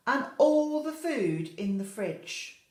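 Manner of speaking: drawn-out, booming list; storyteller's big build. A narrator's reading. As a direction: relish how much he ate. Building the tiger's greed.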